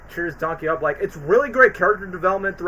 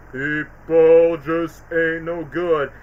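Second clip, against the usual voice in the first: Captain Hook; much slower than usual. Retold or imitated. Quoting the bar pirate, slow and gloomy. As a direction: drag it out like a sad barroom pirate. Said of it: "Captain Hook was like".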